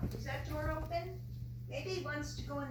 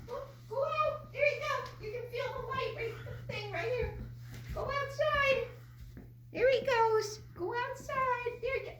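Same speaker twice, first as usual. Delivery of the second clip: coaxing a wild bird; 9 semitones higher than usual. Real, not acted.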